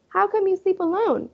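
put-on high, innocent child voice; curious little boy. A narrator's reading. Fudge asking Buzzy why he sleeps alone. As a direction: ask with blunt curiosity.

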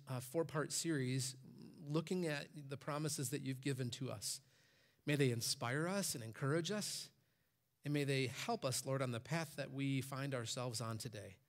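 hushed pastoral prayer. Acted. Closing prayer before sermon.

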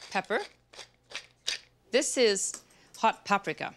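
ordinary delivery

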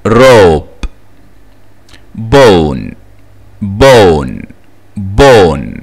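robe, bone, hole, stone, cone with long O; long O, silent E. Shown as a demonstration. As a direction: model each firmly, cone with long O and no final vowel. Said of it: "case number three O consonant E". (robe و bone و cone بنطق O طويلة والـ E صامتة)

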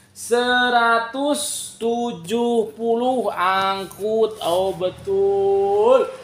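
drawn-out high sing-song price call. Real, not acted. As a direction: stretch the price like a hawker. Live seller calls out price.

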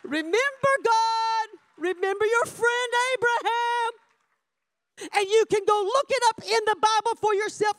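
high, ringing pulpit cry; full-force preaching. Real, not acted. Preacher pressing Moses's prayer on congregation, full-force preaching. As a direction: proclaim it insistently to the whole room.

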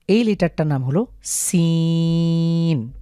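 সিন drawn out slowly with a clear s; Arabic sin. Shown as a demonstration. Teacher naming সিন for viewers, slow. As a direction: stretch সিন. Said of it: "লক্ষ্য করুন সিন কীভাবে উচ্চারণ হয়".

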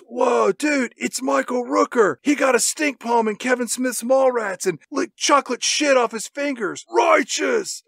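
high-pitched awed stoner dude; hammed-up stoner voice; doped-up snowboarder. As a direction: marvel like a stoned snowboarder spotting a celebrity. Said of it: "our two doped up snowboarders".

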